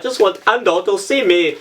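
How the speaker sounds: a put-on, vaguely posh accent nobody could place; lightly voiced royal, playing at being adored; mock Queen voice. The Queen teasingly wishing for Ando's attention, in a mock posh voice. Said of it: "She, she does not talk like that".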